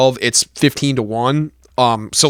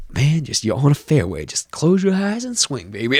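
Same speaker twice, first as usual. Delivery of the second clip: mock hushed coach; 4 dB quieter than usual. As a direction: coo it like a sleazy golf guru. Greasy guy coaching again.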